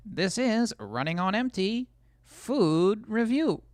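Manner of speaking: high, slow, deadpan reviewer voice; Reviewbrah impression. Streamer imitating Reviewbrah's intro, slow formal reviewer voice. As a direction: announce it like a stiff food reviewer. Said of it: "I kinda sound like Review Bra".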